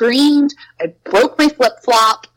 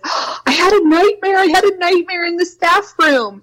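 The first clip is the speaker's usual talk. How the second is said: put-on little girl voice; 10 semitones higher than usual. Upset little girl telling teachers, much higher and childlike. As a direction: speak like a shaken preschooler.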